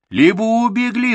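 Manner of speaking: high, loud worried outburst; alarmed. A narrator's reading. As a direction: cry out in sudden alarm. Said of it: "встревожился он".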